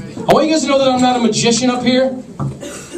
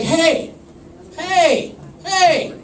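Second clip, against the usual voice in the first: high coaxing hey; 7 semitones higher, much slower than usual. Retold or imitated. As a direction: coax her out of the silence. Calling to a silent girlfriend.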